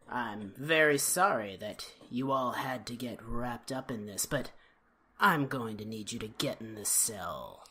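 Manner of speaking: very low, soft purred threat; menacing purr. Retold or imitated. Host imitating a villain sending hostages into the cell, low menacing purr. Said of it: "like, he purrs the line".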